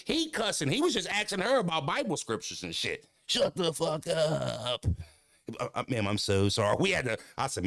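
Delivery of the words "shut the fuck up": drawn-out disbelief. Retold or imitated. Fan's disbelief repeated.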